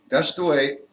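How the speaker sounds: normal talking voice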